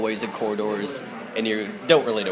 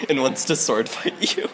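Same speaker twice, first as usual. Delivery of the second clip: ordinary talk, nothing marked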